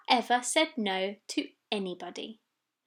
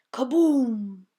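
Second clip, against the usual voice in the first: louder kaboom; kaboom effect; 5 dB louder than usual. A narrator's reading. Narrator voices the thunder, louder. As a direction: boom like thunder.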